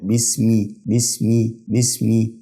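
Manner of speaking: "بسمي" as bismi, clear final i; kasra on the meem. Shown as a demonstration. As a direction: say each "بسمي" separately, ending in mi. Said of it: "فبرضو بنطقه مكسور".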